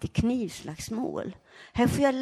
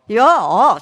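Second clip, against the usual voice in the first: loud cheer; 14 dB louder than usual. Shown as a demonstration. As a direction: call out a big yes. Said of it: "så ska det låta".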